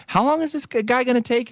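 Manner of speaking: whiny high-pitched impatience; exasperated high. Retold or imitated. Asking the attendant, impatient high squeak. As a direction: squeak it out, fed up with waiting.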